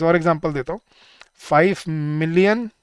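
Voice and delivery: normal talking voice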